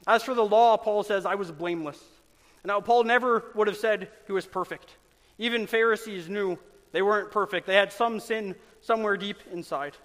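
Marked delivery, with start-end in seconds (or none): none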